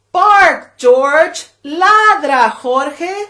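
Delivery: loud high call, bark george ladra jorge; loud rallying command; calling the dog together. Pretend. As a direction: shout the command with the kids.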